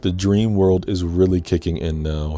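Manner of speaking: usual speaking voice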